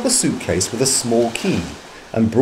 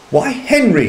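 higher-pitched surprised exclamation; exclaiming; 10 semitones higher than usual. A narrator's reading. Wife realising her husband was right, exclaiming in surprise. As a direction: exclaim it in mild astonishment. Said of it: "she exclaimed".

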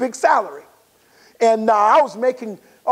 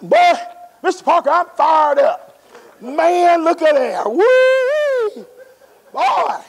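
fired-up joy; 5 semitones higher, slower than usual. Retold or imitated. Retold joy over first check.

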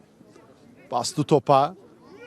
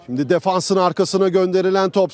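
ordinary delivery

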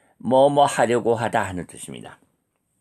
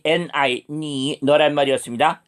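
usual speaking voice

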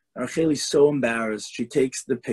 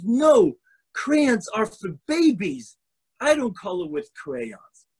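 put-on higher, a touch of a stubborn little girl; defiant child; 6 semitones higher, slower than usual. Hurt girl refuses her mother, high and stubborn.

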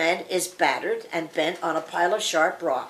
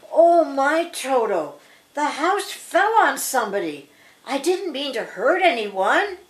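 lightly voiced Dorothy, higher and upset; mild storybook dismay with raised pitch; alarmed Dorothy; 5 semitones higher, 5 dB louder than usual. Dorothy tells Toto about the fallen house, mildly alarmed.

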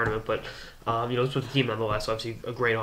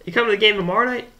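higher, louder, fast friendly question; voicing the general manager; 10 semitones higher, 7 dB louder, faster than usual. General manager inviting the fans back, quick and bright. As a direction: rattle it off like a cheerful exec. GM Sherry voiced in story.

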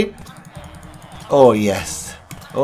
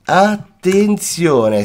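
attenzione stretched very slowly and pitched higher; drawn-out rising warning; 4 semitones higher, much slower than usual. Acted. Gamer repeats the warning to viewers, higher and slower. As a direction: lift the second attenzione, slower still.

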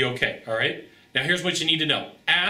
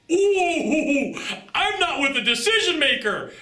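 high, louder, laughing ooh of discovery; delighted realization; 11 semitones higher, 3 dB louder, much slower than usual. Retold or imitated. Seller realizing mid-call buyer isn't decision maker, gleeful high squeal. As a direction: squeal with glee at catching the key fact. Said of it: "And you're like".

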